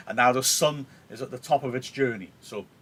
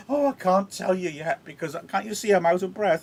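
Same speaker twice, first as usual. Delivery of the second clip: higher, hammed-up excuse; mock breathless nurse; 7 semitones higher than usual. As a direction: whine that there is no breath left. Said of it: "nurse is playing her up now".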